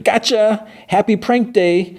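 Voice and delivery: spring the surprise like a giddy roommate; gleeful prank reveal. Retold or imitated. Enid revealing her prank to Wednesday, squealing with glee.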